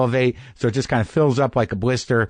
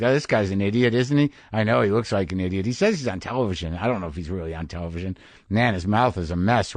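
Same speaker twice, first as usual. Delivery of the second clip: mocking surgeon aside; 5 semitones lower, 5 dB quieter than usual. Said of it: "Are they just gonna start being like".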